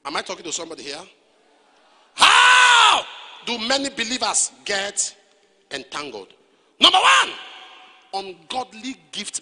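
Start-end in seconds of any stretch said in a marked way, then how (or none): 2.1-3.0 one long, high, loud cry; drawn-out preaching shout. Real, not acted. Preacher cries out question word. 6.8-7.3 high, loud point marker; shouted announcement. Real, not acted. Preacher announces point loudly.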